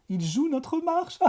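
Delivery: très haut, lent, en riant; joie riante. A narrator's reading. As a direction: ris de joie en sautillant. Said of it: "répétait monsieur Bamwick en sautillant de joie". (Bamwick répète sa marche en riant)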